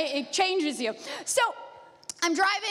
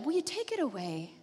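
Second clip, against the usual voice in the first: soft, hushed plea to God; pleading prayer; 3 semitones lower, 7 dB quieter than usual. Retold or imitated. Paul softly begging God to take it away. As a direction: ask quietly, almost breaking.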